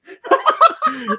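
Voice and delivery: squealing laughter; high laughing fit. Real, not acted. Hard laughter at joke.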